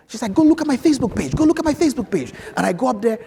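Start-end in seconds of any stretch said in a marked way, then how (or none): 0.3-2.3 high, loud, rapid-fire imitation; excited urging. Cupcake lady urges her adviser online, excited and insistent. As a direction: urge it insistently, pitched way up. Retold caller urging him to look.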